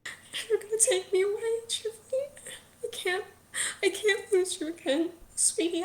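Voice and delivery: high, tearful pleading; terrified mother weeping to her son; crying, scared mother. Acted. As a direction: sob it out in broken, repeated bursts. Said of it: "your mother's crying, Chifley. She's scared".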